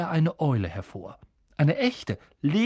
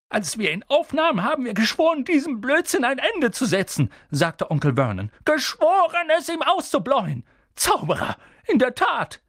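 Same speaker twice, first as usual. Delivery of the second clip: much higher and louder, spitting rage; furious Vernon; 11 semitones higher, 4 dB louder than usual. A narrator's reading. Uncle Vernon rages at Hagrid, much higher and louder. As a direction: hammer each word home. Vernon swore to stop magic.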